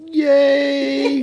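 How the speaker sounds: a sarcastic, high-pitched yay; mock cheer. Husband teasing his hoarse wife with a mock cheer. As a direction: cheer her bad news like a prize.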